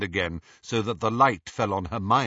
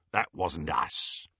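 low, slow defensive reply; lightly voiced low henchman; gruff henchman; 5 semitones lower, 4 dB quieter, slower than usual. A narrator's reading. Greyback answers Lucius's accusation.

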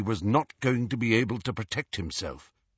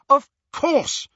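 loud, high cry of realization; 8 dB louder than usual. A narrator's reading. Hermione startling both boys with a realization, crying out. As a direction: exclaim as it suddenly dawns. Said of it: "cried Hermione".

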